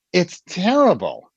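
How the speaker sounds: delighted admission that it's terrible; proud confession. Retold or imitated. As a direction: announce the awful news happily. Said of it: "And you say".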